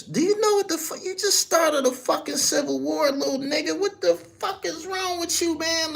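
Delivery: high, excited mock scolding of a reckless friend; hammed-up incredulous big homie, laughing. [name] chewing out [name] after the casino fight, incredulous mock scolding.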